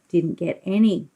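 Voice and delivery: usual speaking voice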